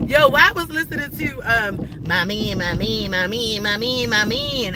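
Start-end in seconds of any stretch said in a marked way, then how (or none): 2.1-4.8 imitating [name]. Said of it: "I thought that was [name]".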